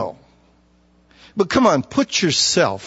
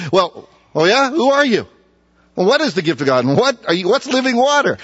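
higher, louder excited questions; eager questioning; 7 semitones higher, 3 dB louder than usual. Retold or imitated. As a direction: fire off the questions with curiosity. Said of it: "You'd ask me".

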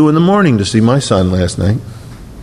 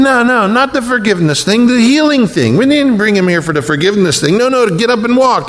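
friends protesting; 8 semitones higher than usual. Retold or imitated. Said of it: "they're saying".

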